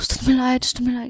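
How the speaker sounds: flustered over-apology. Shown as a demonstration. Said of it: "kalian jangan bilang".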